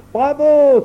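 high, loud cheer; jubilant exclamation. A narrator's reading. Barter hailing the verdict, exclaiming jubilantly. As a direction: burst out in triumph. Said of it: "s'écria Barter Hayes".

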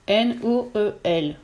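each letter set apart, slow; N, O, E, L said slowly and separately; spelled slowly. A narrator's reading. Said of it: "Lentement ... trace une à une les lettres".

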